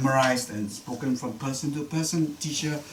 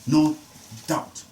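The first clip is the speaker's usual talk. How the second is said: ordinary talk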